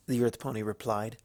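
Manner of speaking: ordinary delivery, nothing marked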